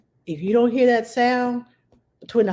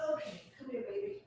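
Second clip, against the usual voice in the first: soft cooing; 5 semitones higher, 18 dB quieter, faster than usual. Acted. Picking up the demo baby doll.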